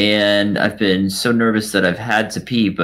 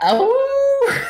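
high startled oh; surprised. Real, not acted. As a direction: yelp in surprise. Reminded of werewolf dare.

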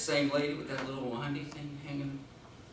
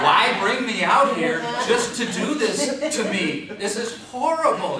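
loud, slow, laughing complaint; laughing lament; 8 semitones higher, 13 dB louder, slower than usual. Pretend. Comedian laments his circumcision to the laughing audience. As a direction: wail through laughter.

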